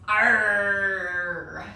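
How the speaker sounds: loud drawn-out pirate roar; pirate cry. Acted. Teacher roars as a pirate for the kids, loud. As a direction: bellow like a pirate charging the boat. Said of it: "One more time".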